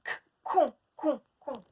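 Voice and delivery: bare "K", then "con" repeated with a hard k; highlighted k sound. Shown as a demonstration. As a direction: sound out the first consonant of "con". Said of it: "On entend le k".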